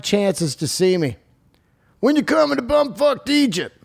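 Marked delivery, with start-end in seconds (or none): 2.0-3.8 high-pitched pestering fan voice; mock caller. Mock caller pestering host about tour dates, high voice. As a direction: pipe up high as a nagging caller.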